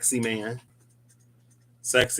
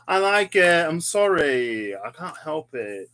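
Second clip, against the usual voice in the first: higher-pitched, drawn-out British accent; put-on British accent; 6 semitones higher, 4 dB louder than usual. Real, not acted. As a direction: slip playfully into a British accent. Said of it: "I busted into an accent".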